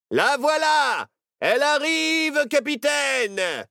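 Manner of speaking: high, loud lookout's holler; yelling. A narrator's reading. Pirate lookout alerts the captain, yelling. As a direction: holler it out, stretching the last word. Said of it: "hurla un pirate".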